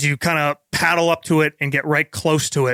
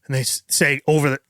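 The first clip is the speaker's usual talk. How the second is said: normal talking voice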